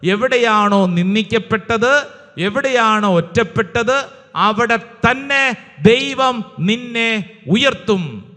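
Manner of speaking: calls out each acclamation loudly, rousing the church; loud rallying praise shout, hammered hard; loud preacher acclamation. Real, not acted. Preacher rousing the congregation to answer, in a loud rallying call.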